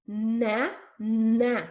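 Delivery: NA... NA, long held N, separate beats; over-articulate each NA with a pause between; exaggerated sounds. Shown as a demonstration. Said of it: "I'm exaggerating the sounds in my mouth".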